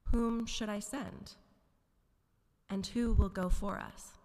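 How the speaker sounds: a hint of a commanding divine voice; the Lord's voice. A narrator's reading. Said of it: "I heard the Lord's voice saying".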